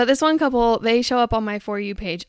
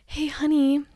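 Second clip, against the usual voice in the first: higher, lightly put-on sweet call; mock TikTok husband; 5 semitones higher than usual. Husband filming his wife, mock sweet. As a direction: imitate a doting husband on camera. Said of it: "They're like".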